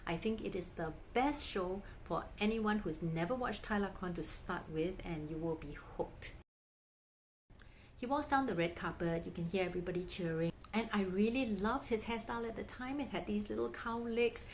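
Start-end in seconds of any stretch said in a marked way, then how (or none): none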